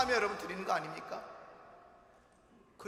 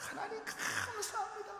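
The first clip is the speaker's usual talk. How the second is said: humble thanks; 13 semitones higher, 10 dB quieter than usual. Acted. Prostrate leper thanking God.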